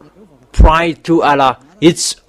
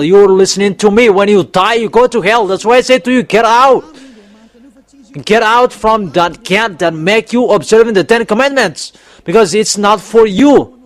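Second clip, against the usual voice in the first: fiery preaching; 5 semitones higher than usual. Acted. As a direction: drive the hell warning home. Preaching hell warning.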